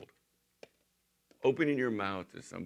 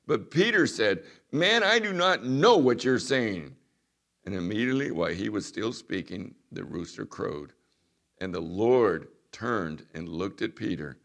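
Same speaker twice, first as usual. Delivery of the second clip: loud, raised pulpit reading of the Gospel; scripture reading; 9 dB louder than usual. Acted. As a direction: proclaim the verses to the congregation. Reading scripture aloud to congregation.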